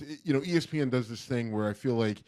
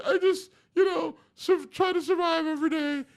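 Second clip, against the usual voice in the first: much higher voice, mock tearful mother; grieving mom. Imitating the grieving mom at the ballpark, high and mock tearful. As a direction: play the brave grieving mother, voice breaking. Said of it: "then the mom's just like".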